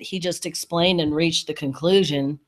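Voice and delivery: ordinary delivery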